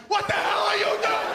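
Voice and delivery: high, loud laughing yell at the driver; mock road rage. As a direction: scream at the other car, half laughing. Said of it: "We're like".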